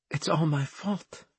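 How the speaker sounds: high, soft husky woman's voice. A narrator's reading. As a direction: confess it in a high, husky hush. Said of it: "she said in a husky voice".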